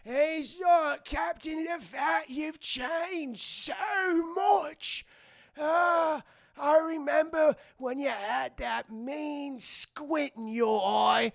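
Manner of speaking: put-on high, very slow old rat voice; drawn-out doddery pirate; ancient cabin boy. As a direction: reminisce like a doddering old sailor. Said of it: "bring over the cabin boy".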